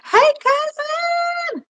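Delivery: high, slow, drawn-out hello; thrilled to see her cousin arrive; excited greeting. Real, not acted. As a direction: sing out the greeting with delight. Greeting a viewer joining the live.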